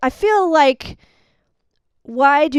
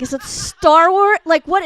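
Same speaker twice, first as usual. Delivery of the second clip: confused; 6 semitones higher, 6 dB louder than usual. Retold or imitated. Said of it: "and I was like".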